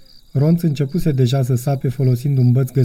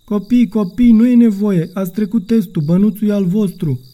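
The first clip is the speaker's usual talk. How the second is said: put-on familiar old man's voice; 7 semitones higher than usual. A narrator's reading. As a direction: drop the monster act, reassure the kids. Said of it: "pe o voce cunoscută".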